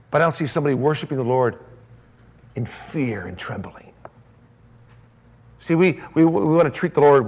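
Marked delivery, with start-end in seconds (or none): 2.6-3.9 hushed, slow whisper; soft slow whisper of fear. Acted. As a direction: drop to a trembling whisper. Preacher hushes on fearful phrase.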